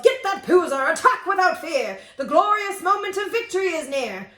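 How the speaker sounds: rally the troops with a roar; hammed-up general's charge; yelled. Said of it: "he yelled".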